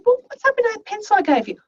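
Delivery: high, quick retold question; puzzled teacher's query. Teacher asks a kid about missing pencils, puzzled. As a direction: ask in a lifted, hurried voice.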